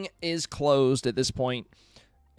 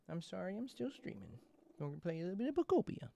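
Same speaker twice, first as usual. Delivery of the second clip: quiet; 3 semitones higher, 13 dB quieter than usual. Real, not acted. Still talking to cat.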